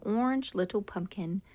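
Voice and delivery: ordinary delivery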